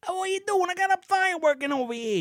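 a hint of a New York wise guy, mocking the old man; mock New York Guido. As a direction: toss it off in a hammed-up New York accent. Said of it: "the most New York response".

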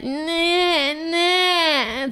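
high, drawn-out mock whine; long, high-pitched imitation whinge; whining child. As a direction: imitate a toddler wailing in protest. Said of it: "han gnäller".

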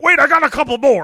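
loud, high, fast eager quip; Freddy still on fire. Retold or imitated. Host imitating burning Freddy, loud and eager. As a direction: rush it out like a burning showman. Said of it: "He's like".

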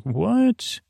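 high-pitched, stunned "what?"; mock everyone's disbelief, pitch shooting way up; high incredulous questioning. Retold crowd reacts in disbelief.

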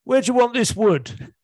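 loud, high, baffled punchline delivery; the lost driver asking where to drop the wood, shouting it out. Acted.

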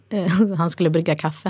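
ordinary delivery

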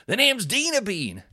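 big, high showman name announcement; imitation of a comic's grand self-introduction; Dino Bean. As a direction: proudly belt out your stage name. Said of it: "It was".

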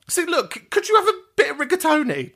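normal talking voice

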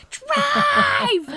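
very high, very loud panicked scream; screamed drive. Retold or imitated. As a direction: scream it at the driver to get away. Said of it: "I screamed".

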